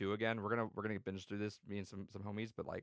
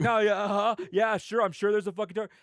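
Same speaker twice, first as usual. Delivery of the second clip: mock sarcastic yeah-sure, higher and louder; hammed-up scoffing at the claim; sarcastic disbelief; 9 semitones higher, 10 dB louder than usual. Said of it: "He's like".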